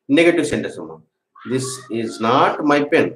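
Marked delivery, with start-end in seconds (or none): 1.5-3.1 very slow dictation. Shown as a demonstration. Teacher dictating a negative sentence, very slowly. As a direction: draw out "This is not my pen." and lean on not. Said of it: "teacher dictating negative sentence".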